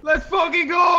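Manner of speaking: loud, high cowboy whoop; excited shout. Real, not acted. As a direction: holler it like a cowboy.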